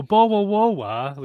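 loud, slow, exaggerated English accent noises; terrible typical English. Shown as a demonstration. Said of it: "the terrible typical, like, English".

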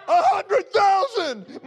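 high, slow, laughing shout; shouted vow. Retold or imitated. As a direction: cry it out in joy. Shouting a bigger number, laughing.

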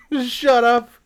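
high-pitched strained yell after a laugh; exasperated mock yell. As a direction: replay an exasperated outburst. Repeats his retold yell after laughing.

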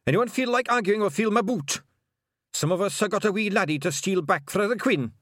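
high, loud Scottish bluster; bully and rally the clan; commanding pixie yell; rallying shout. Acted. Leader shouts down argument, rallies pixies.

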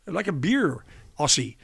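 high, loud, friendly American golfer; golfer offering a beer. Retold or imitated. Arnold Palmer offering a beer in the locker room, imitated high and loud. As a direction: call out cheerily to the young Aussie. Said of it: "and says".

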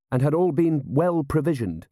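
heavy with polite implication; meaningful. A narrator's reading. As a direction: add it pointedly to a superior. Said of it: "he added meaningfully".

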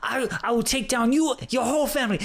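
high-pitched unhinged ranting, imitated; crazed threats. Swindler threatening his ex by voicemail, mock crazed rant. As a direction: act out a man going nuts, threatening. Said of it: "he goes nuts".

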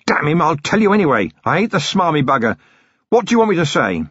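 a beaten thug begging a deal; villain blurting a plea; Stratford, desperate. Acted. Stratford growls from the floor.

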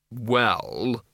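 hesitant, drawn-out "well"; doubtful and lingering; uncertain. A narrator's reading. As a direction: stall on the word, unsure. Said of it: "said Missus Cake uncertainly".

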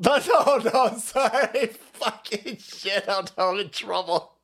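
slow words broken up by laughter; cracking up while owning the mistake; real laughing panic. Real, not acted. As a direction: laugh helplessly through the apology. Laughs at being caught out.

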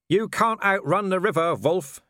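high, loud defiant call; shouted. Acted. Vimes calls from the boat to Wolf, shouted defiance. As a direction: raise the voice to carry over distance. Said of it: "he shouted".

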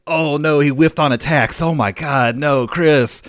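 loud, high dismay, re-enacted; agonized fan yelling at the match; freaking out. Friend watching Chris whiff on attacks, freaking out. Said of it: "just, like, freaking out".

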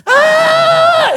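put-on high screaming kid yelp; Home Alone scream. Grandson fleeing grandpa, mock Home Alone scream. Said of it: "he goes, like that kid on Home Alone".

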